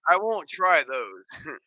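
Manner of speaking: "I won't try those" drawn out slowly; slow emphatic refusal. Real, not acted. Repeating the refusal.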